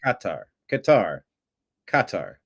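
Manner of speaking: Qatar repeated as "KAH-tar", falling each time; copied pronunciation. Shown as a demonstration. Host trying out the Emir's way, Qatar as "KAH-tar". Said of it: "He pronounced it".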